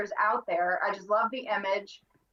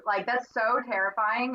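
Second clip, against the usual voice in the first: normal talking voice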